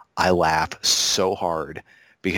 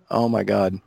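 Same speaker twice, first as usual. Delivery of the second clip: ordinary delivery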